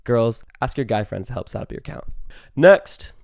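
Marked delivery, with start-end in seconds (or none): 2.6-3.1 sudden loud call, rising; loud burst. Real, not acted. Announcing the next dating tip to viewers, loud burst. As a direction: blurt it out to kick off the next tip. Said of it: "let's calm it down".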